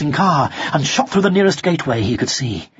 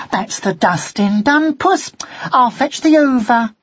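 a lightly voiced cleaning lady, a bit higher; chatty cleaner talking to the cat; 8 semitones higher than usual. Cleaner speaks to the kitten.

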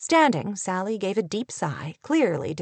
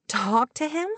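incredulous, higher, rising question; derisive echo; 5 semitones higher than usual. A narrator's reading. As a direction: echo it back with scorn. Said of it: "echoed with a short, derisive laugh".

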